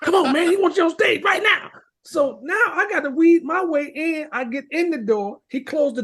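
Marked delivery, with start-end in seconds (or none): 0.0-1.7 louder, higher, pushing him to hurry; urgent excited call. Retold or imitated. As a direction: hurry him on stage, pumped up. Morris urging him on stage.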